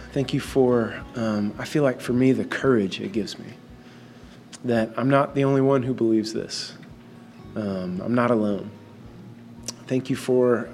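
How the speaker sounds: low, soft, unhurried prayer. Real, not acted. Leads opening prayer.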